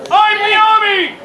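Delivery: rallying cry. Acted. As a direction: proclaim it like a battle cry. Feeds volunteer a battle cry.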